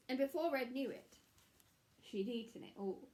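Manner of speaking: ordinary delivery, nothing marked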